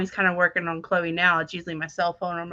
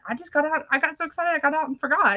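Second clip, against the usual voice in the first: retold sheepish excuse; 8 semitones higher, faster than usual. Acting own excuse to dad.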